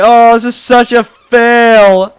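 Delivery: drawn-out, high, loud retold lament; mock dismay. Recalling his own fail in the meeting, mock dismay. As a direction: wail it slowly in comic despair.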